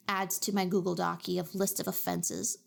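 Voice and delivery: ordinary talk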